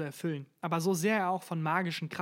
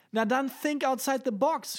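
shouted, much higher and louder; shouting; 8 semitones higher, 5 dB louder than usual. A narrator's reading. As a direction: yell it at him. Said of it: "schrie ich".

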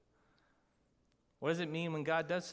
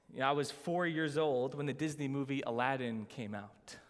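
ordinary delivery

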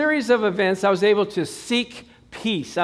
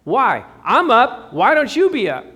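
louder and higher, teasing; mock loud retort; 5 semitones higher, 7 dB louder than usual. Preacher at the door answering the sleepy wife, cheeky. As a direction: toss it back at her cheekily.

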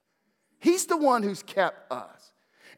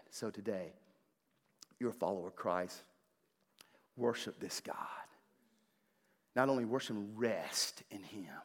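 hushed; 9 semitones lower, 14 dB quieter than usual. Real, not acted. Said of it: "quiet close of sermon".

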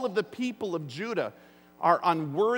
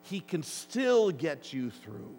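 slow reassurance; 3 semitones lower, 3 dB quieter, slower than usual. Acted. Preacher's key reassurance.

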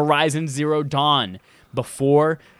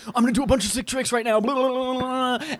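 excited skater; 11 semitones higher than usual. Retold or imitated. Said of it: "you're like".

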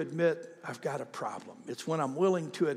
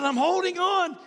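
high, loud cry of clinging on; acted fright; 6 dB louder than usual. Skydiver clinging to the plane door, acted fright. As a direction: play the terror high and loud. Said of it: "I'm scared to death".

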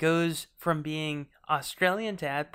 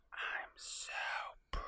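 whisper; 16 dB quieter than usual. Retold or imitated. Whispered echo of the quote.

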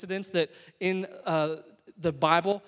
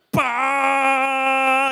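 loud long honk sound, higher; imitated horn blast; 6 semitones higher, 9 dB louder than usual. Frustrated preacher mimics laying on the horn, loud blast. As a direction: lean on the horn with your voice. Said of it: "you just lay on it".

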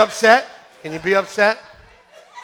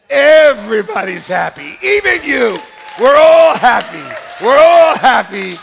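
jubilant preaching; 6 semitones higher, 8 dB louder than usual. Real, not acted. Preacher celebrating that everyone who met Jesus is happy, jubilant shout. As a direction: shout it out in full triumphant force. Climax of the enacted meeting with Jesus.

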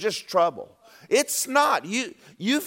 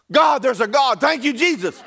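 louder, higher joyful thanks with a laugh; relieved praise; 7 semitones higher, 6 dB louder than usual. Retold or imitated. Relieved exclamation after release.

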